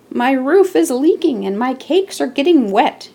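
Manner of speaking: a lightly voiced fretting baker; complaining about the leaking roof; moaning baker. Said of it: "he moaned".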